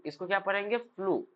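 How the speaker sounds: usual speaking voice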